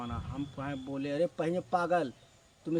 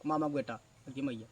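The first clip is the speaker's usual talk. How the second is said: normal talking voice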